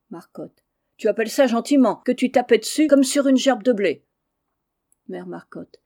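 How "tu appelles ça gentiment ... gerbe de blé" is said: loud quarrel with his wife; angry shouting. Acted. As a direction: bawl him down in a spat. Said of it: "criant à qui mieux mieux". (mari qui crie contre sa femme)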